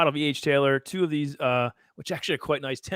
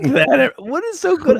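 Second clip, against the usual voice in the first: high voice; 13 semitones higher, 8 dB louder, slower than usual. Pretend. High squeaky voice mock.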